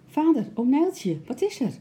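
high-pitched, anxious call; worried child's high voice; urgent questioning. A narrator's reading. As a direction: call out to find out what's wrong. Child anxiously asks what happened.

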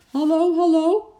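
high, loud, urgent hello; calling down the phone. A narrator's reading. Panicked man rings the police, calling loudly. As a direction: cry out for someone to answer. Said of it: "riep hij door de telefoon".

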